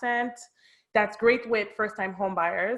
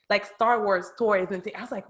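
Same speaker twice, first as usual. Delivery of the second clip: usual speaking voice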